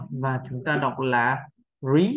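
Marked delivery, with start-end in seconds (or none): 1.8-2.1 "re" stressed, drawn out with a rising pitch; stressed syllable. Shown as a demonstration. Teacher showing learners the stressed second syllable, stressed. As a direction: put the stress on "re", lifting it. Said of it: "âm được nhấn trọng âm".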